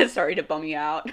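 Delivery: laughing through the words. Real, not acted. Apologizing to people who asked about her name, laughing. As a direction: giggle while apologizing. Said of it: "laughs around line".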